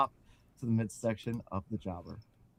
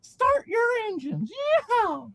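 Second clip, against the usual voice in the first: loud, slow falsetto holler; LaFranc screaming; 10 dB louder, much slower than usual. Acted. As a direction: holler it like a squeaky race starter. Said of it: "he screamed".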